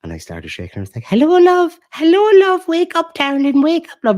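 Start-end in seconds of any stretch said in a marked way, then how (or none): 1.1-4.2 put-on very high, loud old granny voice; hammed-up falsetto granny, loud and insistent; Nanny. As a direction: screech it like a granny waking someone. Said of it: "the first laugh that Nanny got".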